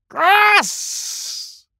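very high, shrill parrot squawk; parrot screech. Acted. Parrot screeches to the animals.

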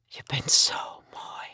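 slow, breath-only whisper. A narrator's reading. Man admiring his lover in bed, whispered. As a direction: whisper it slowly and tenderly. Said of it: "fluisterde hij".

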